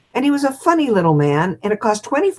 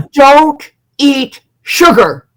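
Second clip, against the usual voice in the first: loud, slow, looming command from behind the screen; booming hypnotist command; 6 semitones higher, 9 dB louder, much slower than usual. Retold or imitated. Hypnotist on the microphone, booming slow command. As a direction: boom it out like the Wizard of Oz. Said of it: "He says".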